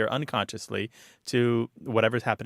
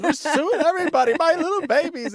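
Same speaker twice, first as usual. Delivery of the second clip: high, loud hammed-up wailing; mock panic; 7 dB louder than usual. Parent exaggerates a child's tumble to deflect it, mock-panicked wail. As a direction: overdo the parental hysteria as a joke. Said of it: "make the situation sound worse".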